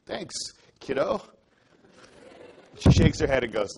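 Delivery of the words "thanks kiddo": breezy higher cover-up thanks. Retold or imitated. To the unknown student, breezy cover-up. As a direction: toss it off to hide that you don't know her. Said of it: "So you cover it up".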